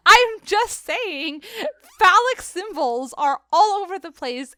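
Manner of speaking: slow, high, laughing through it; laughing insistence. Real, not acted. Host laughing as she argues phallic symbols. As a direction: insist while cracking up.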